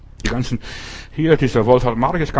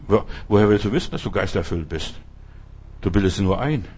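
usual speaking voice

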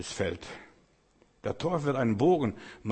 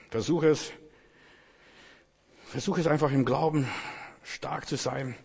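urging push; 3 semitones higher than usual. Shown as a demonstration. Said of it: "So, versuch es".

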